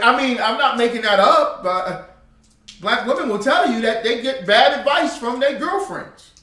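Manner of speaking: loud, high, driving sermon voice; fiery preaching. Acted. Preacher scolding the old generation, loud and fired up. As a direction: hammer the congregation about bad elders.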